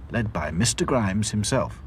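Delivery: usual speaking voice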